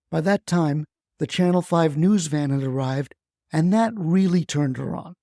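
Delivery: ordinary delivery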